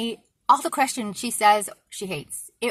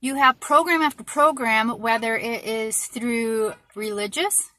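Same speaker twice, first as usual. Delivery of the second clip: usual speaking voice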